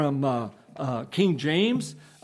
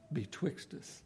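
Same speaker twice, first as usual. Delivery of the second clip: much softer, almost breathed; hushed; 8 semitones lower, 9 dB quieter than usual. Real, not acted. Preacher ending softly to the congregation, hushed. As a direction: drop to a hush for the close. Soft closing about the daysman.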